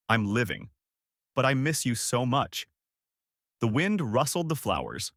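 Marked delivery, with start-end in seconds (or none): none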